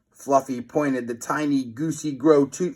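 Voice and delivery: plain reading voice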